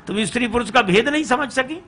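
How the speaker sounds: quick, high-pitched rebuke; scolding question. Acted. Father-in-law rebukes both women, quick scolding question. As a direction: fire off the reproach as a high question. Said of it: "दोनों को फटकारा".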